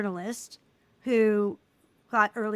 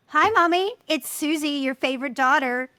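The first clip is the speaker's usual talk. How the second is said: bright, much higher and louder hello to mom; cheerful loud greeting; 5 semitones higher, 6 dB louder than usual. Retold or imitated. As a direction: greet her like a big happy surprise. Greeting her mom on every visit.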